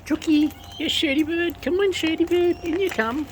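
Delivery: high coaxing pet voice. Real, not acted. Owner calling pet birds in, high sing-song coaxing. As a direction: coax the birds in with a lilting high voice.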